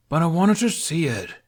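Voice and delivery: high, loud whine; whiny. A narrator's reading. Halfdan whining to Hiccup about missing the flight. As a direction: whine like a big sulking kid. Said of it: "Halfdan's whine".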